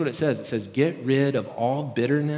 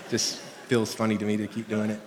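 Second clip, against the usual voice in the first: normal talking voice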